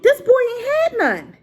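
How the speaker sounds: high-pitched incredulous exclamation; retold outrage. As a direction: squeal it in mock outrage. Acts out own outraged reaction.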